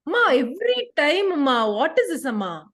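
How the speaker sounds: high, loud complaint to mum; exasperated child, pitch jumping up; protesting teenager. Acted. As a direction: whine back like a fed-up teen. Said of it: "more like a teenager".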